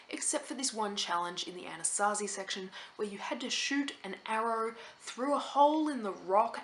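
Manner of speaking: normal talking voice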